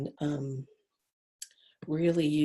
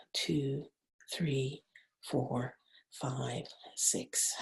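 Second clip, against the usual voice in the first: counting slowly, much softer, fading to a whisper; soft breath counting; 8 dB quieter, much slower than usual. Acted. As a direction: guide the breath count gently.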